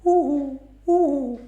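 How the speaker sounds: high hooting uhu; owl hoot. Pretend. Owl flies past the hens hooting, high-pitched. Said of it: "seekor burung hantu".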